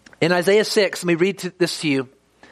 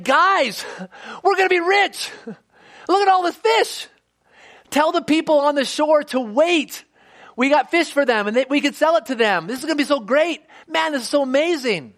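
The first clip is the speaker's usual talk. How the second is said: excited; 8 semitones higher than usual. Pretend. Imagined Peter celebrating record catch.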